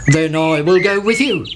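put-on rooster voice, climbing then dropping; Cocky Locky. A narrator's reading. Rooster offers to join the chick, put-on crowing voice. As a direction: crow the offer lightly, rising late. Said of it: "said Cocky Locky".

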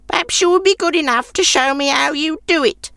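put-on high, grudging old woman voice; curious old woman. A narrator's reading.

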